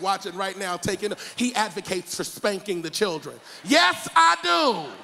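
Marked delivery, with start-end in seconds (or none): 3.6-4.9 high, loud, drawn-out confession; emphatic shout. Real, not acted. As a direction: shout it out proudly, slowly. Pastor shouts confession to crowd.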